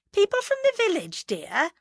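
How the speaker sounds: high-pitched, placating wifely chirp; nervous wife. A narrator's reading. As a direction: explain it away sweetly, pitched right up. Nervous wife covers to husband.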